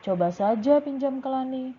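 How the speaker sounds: lifted, lively reply as Dayu; role-play as Dayu. Shown as a demonstration. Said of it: "Bu Guru yang menjawab juga".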